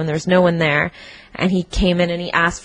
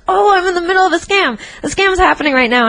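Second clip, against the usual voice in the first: excited; 10 semitones higher, faster than usual. Retold or imitated. Retold excited inner thought.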